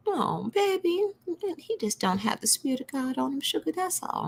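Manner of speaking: high, soft, sugary grandma. Retold or imitated. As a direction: coo it sweetly, like granny soothing. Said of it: "anytime I do the granny voice".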